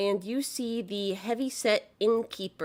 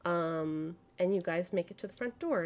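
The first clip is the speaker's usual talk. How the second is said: ordinary delivery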